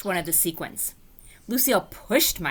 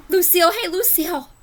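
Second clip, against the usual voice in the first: sudden idea; 11 semitones higher, 5 dB louder than usual. Acted. Junie B gets a great idea.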